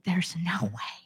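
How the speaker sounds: hushed, breathy "There's no way"; whispered doubt. Acted. Preacher voicing a doubter's thought, whispered.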